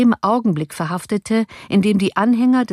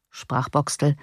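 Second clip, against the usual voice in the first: plain reading voice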